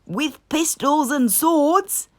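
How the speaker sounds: rally the troops; shouting Rat. A narrator's reading. Rat shouting for weapons, eager. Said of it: "shouted Rat".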